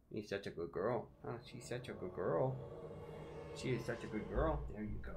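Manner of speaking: praising the cat softly. Real, not acted. Cooing to cat.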